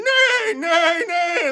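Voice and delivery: high, loud whinny; high hammed-up neigh; horse neigh.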